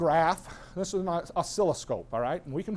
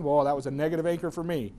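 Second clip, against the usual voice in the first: usual speaking voice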